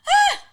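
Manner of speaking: sudden high, loud shriek of fright; frightened scream. Retold or imitated. Girl too scared to let go of the rope, shrieking.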